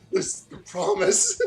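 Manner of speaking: laughing through the words. Real, not acted. Reader laughing at the fanfic tag, giggling. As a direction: crack up mid-line.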